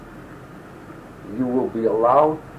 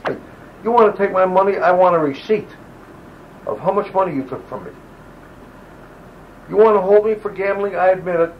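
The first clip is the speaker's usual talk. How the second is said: raised, higher-pitched indignant demand; a soldier refusing to hand over his winnings, louder and higher in defiance; defiant retold protest; 5 semitones higher, 4 dB louder than usual. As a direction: throw the challenge back at the officers, loud and high. Soldier defying officers over money.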